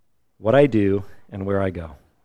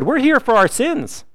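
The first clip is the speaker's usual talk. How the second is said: high-pitched, louder challenge; indignant rebuke; 14 semitones higher, 6 dB louder than usual. Retold or imitated. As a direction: rebuke him in a much higher, raised voice. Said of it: "he rebuked the other one".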